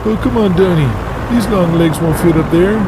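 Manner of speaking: Waylon voice. Acted. Waylon complaining.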